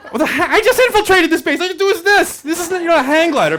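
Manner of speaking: high, laughing mock boast of an intruder; mock infiltrator. Intruder bragging about his hang glider, high laughing mock voice. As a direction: toss it off like a proud amateur invader.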